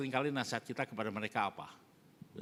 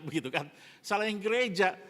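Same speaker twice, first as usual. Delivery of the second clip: ordinary talk, nothing marked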